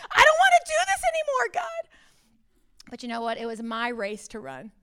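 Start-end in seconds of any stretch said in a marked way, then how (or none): 0.1-1.9 fed-up cry. Retold or imitated. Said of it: "I'm so like".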